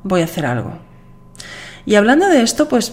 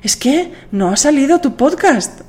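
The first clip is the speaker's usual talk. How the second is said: concerned listener; 7 semitones higher than usual. Retold or imitated. Listener asking why the podcast is late, concerned. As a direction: imitate a listener checking she's fine. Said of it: "una persona me dijo".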